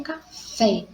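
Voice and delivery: "café" split, with a held, stressed FÉ; teacher sounding out "café" with weight on FÉ; stress on last syllable. Shown as a demonstration. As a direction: lean on the final FÉ of "café". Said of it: "Uma oxítona terminada em E".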